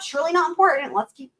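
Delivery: ordinary delivery, nothing marked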